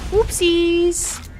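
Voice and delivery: squeaky high "Whoopsies!"; high squeak. Real, not acted. Streamer fumbles a move mid-match, high squeak. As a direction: yelp it out, caught off guard. Sudden mistake in game.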